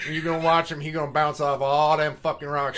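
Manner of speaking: loud mock Tennessee country narrator; Tennessee accent. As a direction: imitate a Tennessee guy calling the action. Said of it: "some guy from, like, Tennessee".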